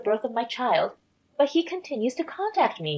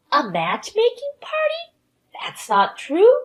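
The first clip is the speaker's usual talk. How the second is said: high, loud, incredulous question and denial; shocked disbelief; 7 semitones higher, 5 dB louder than usual. Acted. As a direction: echo it back in rising disbelief. Camilla learns of the matchmaking party.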